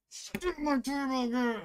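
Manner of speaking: high, slow hammed-up wail; mock dramatic outburst. Teasing Brian with a mock dramatic cry. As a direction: ham it up like a soap villain. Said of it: "I'm just kidding".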